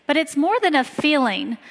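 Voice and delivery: ordinary talk, nothing marked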